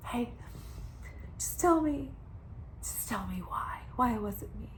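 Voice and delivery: hushed, near-whispered mock plea; acted calm plea. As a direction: act out a quiet, restrained appeal. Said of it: "meets up with him calmly".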